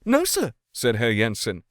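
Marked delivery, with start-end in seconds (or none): 0.0-0.5 high, sharp retort; rather sharp. A narrator's reading. Lawyer answering Andersen sharply. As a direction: snap the answer back. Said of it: "rather sharply".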